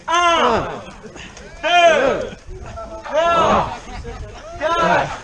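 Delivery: counting while doing squats. Real, not acted. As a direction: count out loud mid-squat. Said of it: "Sur cinq squats".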